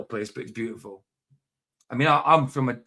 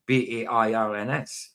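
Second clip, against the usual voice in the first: ordinary talk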